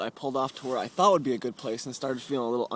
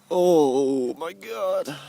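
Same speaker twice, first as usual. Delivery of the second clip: gasping in icy wind; 5 semitones higher, 9 dB louder, much slower than usual. Real, not acted. Stepping out into the cold.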